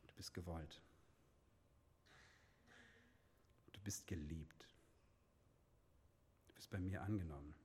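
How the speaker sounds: gentle whispered reassurance to a listener who feels ashamed; tender fatherly voice. Acted. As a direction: speak as a loving father to a shamed child. Loving Father's voice, quoted.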